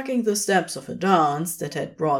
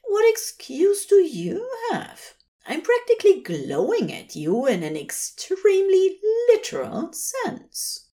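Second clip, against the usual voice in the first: Aziraphale's voice; 13 semitones higher than usual. A narrator's reading. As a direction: protest with bright indignation. Aziraphale retorting.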